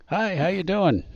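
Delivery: high, hearty hello; booming glad-hander's greeting; loud talking guy. Acted. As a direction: greet a stranger at full boisterous volume. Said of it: "you've seen this loud talking guy".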